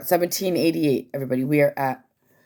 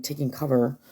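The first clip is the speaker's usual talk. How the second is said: ordinary talk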